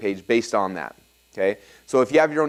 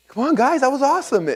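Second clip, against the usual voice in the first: much higher and louder, pleading cheer; desperate hype; 13 semitones higher, 3 dB louder than usual. Retold or imitated. Speaker begging the silent audience to respond, high desperate cheer. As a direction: try to whip up a dead room.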